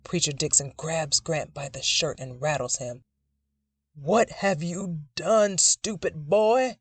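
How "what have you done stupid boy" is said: slow, rising angry bellow; furious roar. Acted. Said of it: "his grandfather roars".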